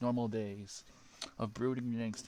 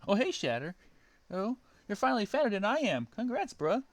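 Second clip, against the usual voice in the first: high, loud, yelping hero voice; Sonic voice; 10 semitones higher, 6 dB louder than usual. Acted. Sonic yelping after Shadow punches him. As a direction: yelp it out as the punched hedgehog. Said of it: "Sonic taunting Shadow".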